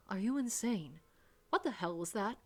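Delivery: ordinary delivery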